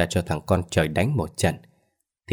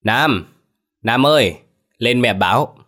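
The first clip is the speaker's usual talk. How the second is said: mother's raised call; higher, carrying summons; 4 semitones higher, 4 dB louder, slower than usual. A narrator's reading. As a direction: call your son to come. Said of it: "mụ gọi".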